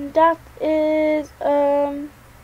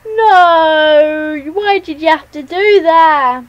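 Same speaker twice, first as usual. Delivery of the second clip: louder wail of dismay; loud upset outcry; 6 dB louder, slower than usual. Real, not acted. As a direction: cry out at the unfairness. Just killed, loud dismay.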